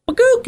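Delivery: squeaky cartoon "ba-goop"; high-pitched mask-slipping noise. Showing an old-school mask going on, cartoon noise. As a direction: pop it out high like a sound effect.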